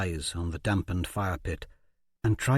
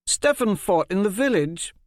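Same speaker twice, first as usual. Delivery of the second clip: much higher, louder insistence; firm defense; 10 semitones higher, 4 dB louder than usual. Acted. Polly Mott defends Stefan, high firm insistence. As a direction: stand up for him.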